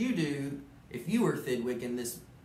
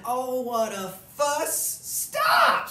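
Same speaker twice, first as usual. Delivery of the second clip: high, loud shrieking critter; shrill-voiced guest screams Stop; screaming guest; 11 semitones higher, 7 dB louder, much slower than usual. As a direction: scream it at the moose in a squeaky voice. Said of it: "screamed his guest".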